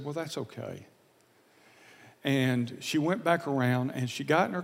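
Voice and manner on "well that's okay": softly voicing the mom's gentle answer; soft reply. The mom forgiving him at the pump, softly. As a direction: let her off the hook, hushed.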